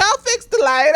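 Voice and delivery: a high mock-heroic volunteer; grandfather voice. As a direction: play the eager grandpa with a lifted voice. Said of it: "he's like".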